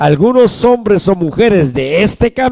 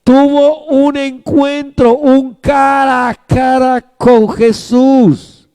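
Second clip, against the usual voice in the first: high, slow preaching cry; stretched-out, exalted declaration; emphatic proclamation; 5 semitones higher, slower than usual. Real, not acted. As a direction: proclaim it slowly on a raised pitch. Preacher proclaims the key point again.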